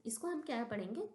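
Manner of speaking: ordinary delivery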